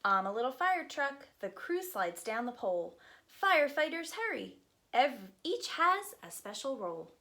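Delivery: lively, sing-song picture book reading; reading to children. Acted. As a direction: read it aloud to a group of little kids.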